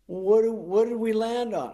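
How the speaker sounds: high, slow mock innocent question; lightly voiced grumpy costar; Nick playing dumb. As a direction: feign forgetting, drawn out. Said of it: "he's like".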